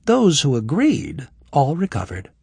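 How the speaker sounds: lightly voiced young woman; Kira's voice. Voices Kira.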